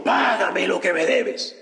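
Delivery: throttle him with the words; a creditor shouting at his debtor; angry demand. Acted. Cruel servant demands payment.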